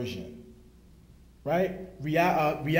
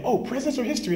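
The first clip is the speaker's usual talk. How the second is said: lightly voiced little girl, higher; a hint of an eager child, pitched up; daughter's voice; 9 semitones higher, 3 dB louder, much faster than usual.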